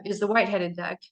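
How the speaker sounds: normal talking voice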